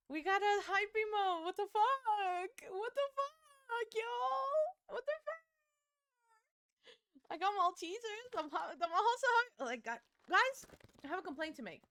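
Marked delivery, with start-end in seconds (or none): 1.4-5.4 high, slow, amazed exclamation; stunned disbelief. Real, not acted. Streamer reacts to a hype emote, amazed. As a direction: let the shock climb higher each time.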